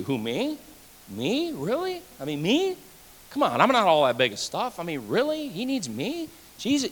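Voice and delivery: soft, incredulous voice of a doubter; doubting listener. Pretend. Pastor voicing a doubter in the pews, soft and incredulous. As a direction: play the unsure believer who can't believe it.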